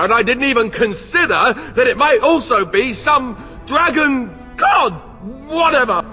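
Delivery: loud, high, biting sarcasm; sarcastic outburst. Acted. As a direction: yell it out with bitter sarcasm. Outraged sarcasm at Morrigan's plan.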